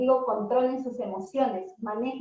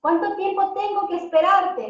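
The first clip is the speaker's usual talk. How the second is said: higher and louder, exasperated; impatient scold; 6 semitones higher, 5 dB louder than usual. Shown as a demonstration. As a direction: let the impatience burst out. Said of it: "Muchas veces usamos".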